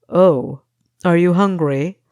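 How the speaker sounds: mock-kindly interrogator; taunting captor. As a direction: dangle the question like bait. Pastry Cook taunts Kessa.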